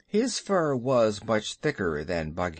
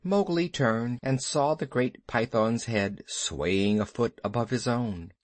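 ordinary delivery

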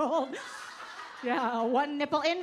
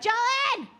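hammed-up yell from a drunk fan; drunk friend yelling; 8 semitones higher than usual. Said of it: "one friend that's like".

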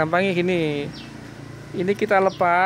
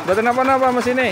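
raised, higher and fast; loud call; 7 semitones higher, 7 dB louder, faster than usual. Real, not acted. Mechanic telling the owner the engine is fine, quick loud call.

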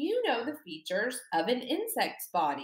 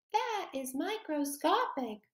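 That is microscopic higher and softer, a little voice; 6 semitones higher, 3 dB quieter than usual. Shown as a demonstration. Teacher modelling the game answer for children: high and soft. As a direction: shrink your voice down small. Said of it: "your microscopic voice".

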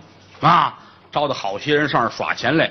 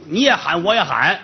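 ordinary talk, nothing marked

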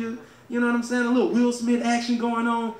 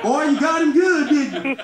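put-on higher, louder, laughing good ol' boy; laughing Bush; 6 semitones higher, 6 dB louder than usual. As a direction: cackle out the praise.

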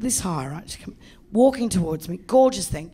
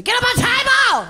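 much higher, loud bossy command; masseuse barking an order; 6 dB louder than usual. Retold or imitated. Masseuse commands the client onto the table, loud high shout. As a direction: snap it out like a drill sergeant. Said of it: "'cause she told me to".

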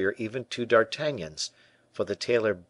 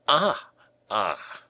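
exclaiming; 3 semitones higher than usual. A narrator's reading. Tailor hears of the friendship with Fouquet, exclaiming in surprise. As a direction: exclaim as the penny drops. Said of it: "exclaimed the tailor".

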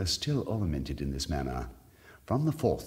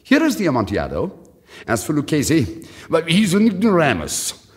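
higher, louder, fast; loud drunken boast; 10 semitones higher, 9 dB louder than usual. A narrator's reading. Fortunato mocking Lucchese, loud and fast. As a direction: blurt it out, interrupting. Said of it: "interrupted my friend".

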